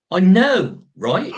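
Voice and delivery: RIGHT as an American-style affirmative tag; [name]. Shown as a demonstration. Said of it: "Liam's got the American affirmative right".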